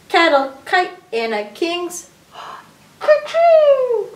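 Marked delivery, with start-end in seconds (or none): 3.0-4.1 big, high mock sneeze; gasp, then a loud drawn-out "kerchoo"; pretend sneeze. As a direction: play-act a sneeze. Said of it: "I remember the kerchoo in my arm this time".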